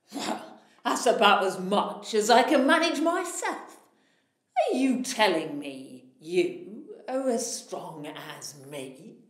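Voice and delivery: a big proud giant sizing up a tiny man; boastful giant. Acted. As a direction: giant challenging the little tailor. Giant speaks to the tailor.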